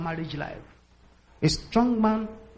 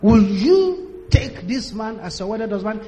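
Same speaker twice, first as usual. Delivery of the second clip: slow, louder, deliberate reading of the vow; pastor repeating vows; 5 dB louder than usual. Retold or imitated. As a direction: read the vow again slowly, as if she misheard. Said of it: "read it all over again to her".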